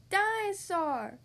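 put-on slow, high toddler cry; toddler wail. A narrator's reading. Crying toddler George.

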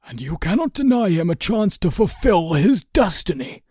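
high, loud impassioned retort; fierce. A narrator's reading. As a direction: throw the argument back forcefully. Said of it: "equally fierce now".

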